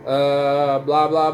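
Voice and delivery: ordinary talk, nothing marked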